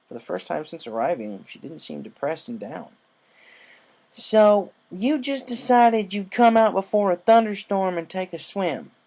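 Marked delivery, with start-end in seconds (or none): 4.1-8.9 incredulous scolding. Acted.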